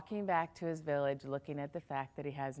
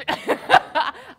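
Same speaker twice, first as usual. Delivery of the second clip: short, much higher burst of laughter; 12 dB louder than usual. Acted. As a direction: laugh it off in disbelief. King's bitter reaction.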